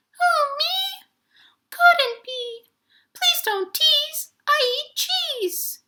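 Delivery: high tiny squeaking mouse; a tiny mouse pleading innocence; squeaky mouse. Acted. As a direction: squeak out a nervous denial. Mouse denies taking cookies.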